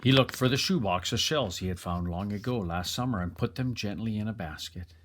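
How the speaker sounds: ordinary delivery, nothing marked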